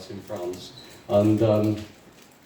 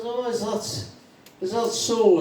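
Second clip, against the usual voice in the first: higher-pitched, tentative query; lightly voiced drinker; 9 semitones higher, slower than usual. Irish drinker returning to the barman, lightly voiced. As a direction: ask it up high, checking.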